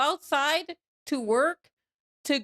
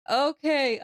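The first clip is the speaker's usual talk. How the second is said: ordinary talk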